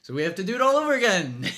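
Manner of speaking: fast, loud laughing rant; cracking up. Real, not acted. Host laughing at having to refilm the episode, loud and fast. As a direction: lose it laughing as you redo it. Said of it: "Can you see me cracking up?".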